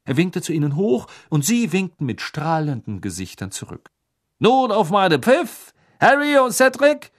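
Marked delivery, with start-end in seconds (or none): 4.4-7.1 high, loud booming announcer; magically amplified voice. A narrator's reading. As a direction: announce to the whole stadium. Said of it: "sagte Bergman".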